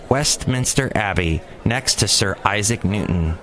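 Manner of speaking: normal talking voice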